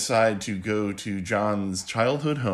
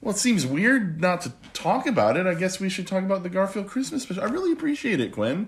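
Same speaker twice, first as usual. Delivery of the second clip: delighted opening a present; 8 semitones higher than usual. Pretend. Dad playing grateful gift recipient.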